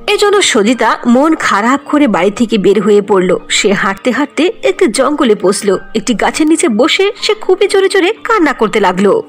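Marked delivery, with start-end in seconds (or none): none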